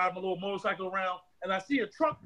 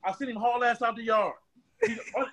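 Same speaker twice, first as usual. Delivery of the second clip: usual speaking voice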